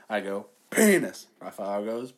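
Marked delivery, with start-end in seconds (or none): 0.7-1.3 a raised, higher "Penis"; a little louder. Retold or imitated. Upping the stakes on her friend in the mall, louder. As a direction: push it out a notch louder. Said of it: "I said a little louder".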